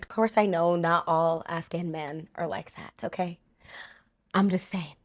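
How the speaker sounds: low, hushed backpedal; quiet disclaimer. Real, not acted. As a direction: drop right down to a soft, low aside. Softened disclaimer after her criticism.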